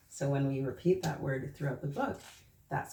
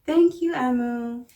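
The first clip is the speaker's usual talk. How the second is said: put-on higher, louder grateful little-boy voice; little boy thanks; 7 semitones higher, 9 dB louder than usual. The little boy thanks Amu for the stew, grateful little-boy voice. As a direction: thank her like a delighted small boy. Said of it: "the little boy said".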